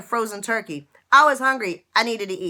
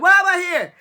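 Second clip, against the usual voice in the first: mocking her old despair; 9 semitones higher, 6 dB louder than usual. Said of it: "my nonsense that I used to talk about".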